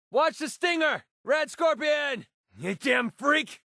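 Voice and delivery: high, drawn-out warning yells; urgent, aggressive combat shout; battle shouting. Acted. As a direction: yell at a monster mid-fight. Combat barks at scorpion.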